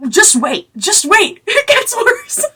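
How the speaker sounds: very high, laughing warning; cracking up while teasing what comes next. Real, not acted. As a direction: squeal it out through real laughter. Laughing ahead of worse plot.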